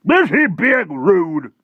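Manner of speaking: slow, very high, loud outburst; affronted Air Force chief. Acted. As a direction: ask it with loud military indignation.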